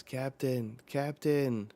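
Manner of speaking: acted anxious call. Crew calling to weeping captain.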